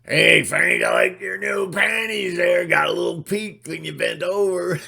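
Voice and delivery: loud, high mocking workman call; a loud hammed-up construction worker; coworker teasing. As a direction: yell it across the site, teasing. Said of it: "construction worker".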